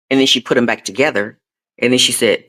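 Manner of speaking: ordinary delivery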